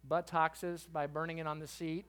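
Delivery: ordinary delivery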